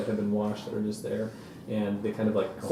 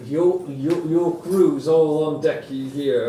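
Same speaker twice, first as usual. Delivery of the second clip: lightly voiced sailor, higher and louder; crew member's voice; 5 semitones higher, 5 dB louder, slower than usual. Sailor answering the player about the crew, lightly voiced. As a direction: draw it out a little in a sailor's role.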